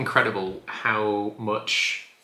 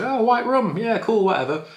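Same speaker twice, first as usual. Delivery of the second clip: dismissive; 12 semitones higher, 6 dB louder than usual. Retold or imitated. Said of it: "and I was like".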